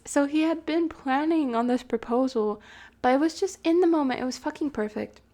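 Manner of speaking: ordinary talk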